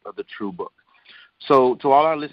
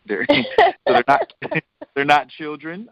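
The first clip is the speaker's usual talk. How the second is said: laughing through the words; amused giggle mid-sentence; 4 semitones higher than usual. Real, not acted.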